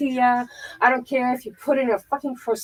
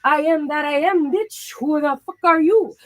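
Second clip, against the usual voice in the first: raised voice, sassy pride; defiant comeback; 4 semitones higher, 3 dB louder than usual. Pretend. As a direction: hit back with swagger. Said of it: "That dude is like".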